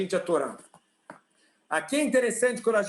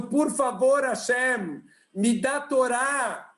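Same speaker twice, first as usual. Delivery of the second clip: pleading prayer; 4 semitones higher, slower than usual. Acted. Man pleading to God.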